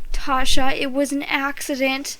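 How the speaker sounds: ordinary delivery, nothing marked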